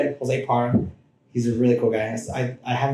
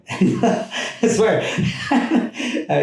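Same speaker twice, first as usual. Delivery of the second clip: high giggling laugh through the words; can't stop laughing while talking; breaking into laughter; 9 semitones higher, much slower than usual. Real, not acted. Laughing about brother's losses.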